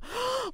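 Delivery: high sharp gasp; dramatic gasp. Retold or imitated. Mocking a big emotional reaction, high gasp. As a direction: gasp like you're overwhelmed. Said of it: "It's not like I'm".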